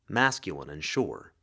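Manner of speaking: ordinary delivery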